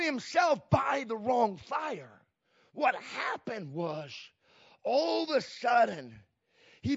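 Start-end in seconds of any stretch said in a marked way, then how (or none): none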